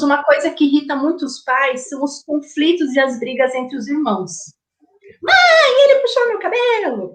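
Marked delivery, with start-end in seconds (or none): 5.2-7.2 put-on whiny child. Child tattling to mother, high and whiny. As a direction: cry out to mom like a wronged kid. Child complaining about sibling.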